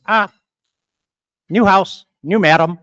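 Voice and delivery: lightly voiced talking parrot; joke parrot. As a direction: call it out in short parrot phrases. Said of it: "parrot looks around, says".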